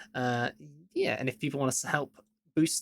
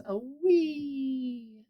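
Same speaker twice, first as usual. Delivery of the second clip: high squeak; 10 semitones higher than usual. Pretend.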